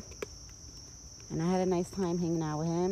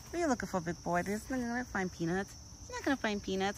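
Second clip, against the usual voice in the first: higher, soft sweet-talk to a pet deer; 4 semitones higher, 8 dB quieter than usual. Real, not acted. Talking sweetly to her pet deer.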